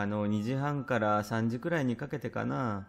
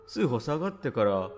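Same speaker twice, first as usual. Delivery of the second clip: ordinary delivery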